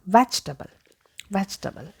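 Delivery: vegetable as VECH-tuh-bul; correct pronunciation. Shown as a demonstration. Teacher models the singular, correct pronunciation. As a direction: say "vegetable" in three syllables. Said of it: "as a singular".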